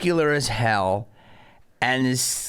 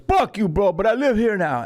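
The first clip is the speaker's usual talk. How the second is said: higher-pitched mock-indignant Jamaican heckler; weird Jamaican accent; 6 semitones higher, 3 dB louder than usual. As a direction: protest in a shaky attempt at Jamaican. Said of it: "You're Jamaican".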